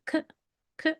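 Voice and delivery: k with a slight uh after it; slight uh added. Shown as a demonstration. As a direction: soften k into a short 'kuh'. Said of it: "slight, uh, can be added".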